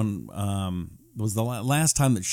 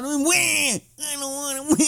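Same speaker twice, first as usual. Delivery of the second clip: high whiny crybaby wail; mocking a critic as a whining baby; 12 semitones higher than usual. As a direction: wail like a toddler throwing a tantrum. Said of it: "and Don".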